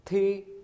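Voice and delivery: थी said high with a rising second tone. Shown as a demonstration. Teacher demos थी to Hindi learners, high rising tone. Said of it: "हम इसको ऊंचा पढ़ेंगे".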